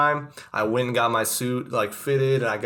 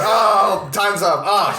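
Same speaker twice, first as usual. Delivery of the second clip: higher, louder startled "oh, time's up"; mock panic; 9 semitones higher, 8 dB louder, much slower than usual. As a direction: caught off guard by the timer. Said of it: "You're like".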